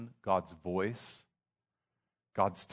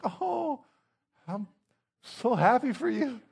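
high, strained mock cheer, much higher pitch; fake happy for you; 13 semitones higher, 3 dB louder than usual. Mocking a jealous friend congratulating a promotion, fake cheerful. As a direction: gush with hollow, forced delight. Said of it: "and you're like".

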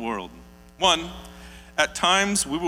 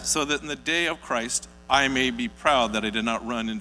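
measured, louder Bible reading; scripture reading; 4 dB louder than usual. Acted. Pastor reading Philippians to the congregation, measured and solemn. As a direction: read the passage with weight.

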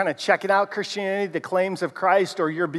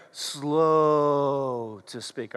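very slow, lower and soft; 6 semitones lower, 5 dB quieter, much slower than usual. Shown as a demonstration. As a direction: stretch each word out slowly. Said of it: "let's just slow it down together".

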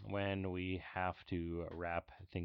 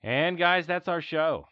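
loud sign-off; 8 semitones higher, 9 dB louder, slower than usual. Real, not acted. Sign-off announcement.

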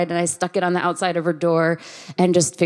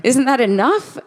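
mock indignant; 5 semitones higher, 5 dB louder, slower than usual. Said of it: "I'm like".

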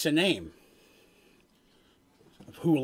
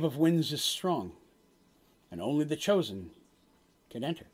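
solemn prophet. Acted. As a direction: deliver a grave omen, phrase by phrase. Game master voices the prophet's prophecy.

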